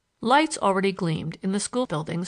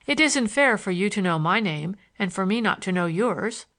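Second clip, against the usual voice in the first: ordinary delivery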